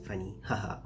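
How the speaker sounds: ordinary talk, nothing marked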